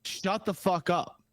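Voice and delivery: stern retold command, high and slow. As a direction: order them firmly, word by word. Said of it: "extremely stern, goes".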